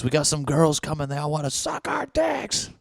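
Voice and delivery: high, eager party bro; bro friend voice. Retold or imitated. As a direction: hype up the news like a horny buddy. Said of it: "Somebody goes".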